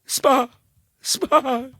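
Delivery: high, loud, broken stammer into a cry; panicked boy stuttering about spiders; terrified stutter. Acted. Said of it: "Bernie sat there stuttering".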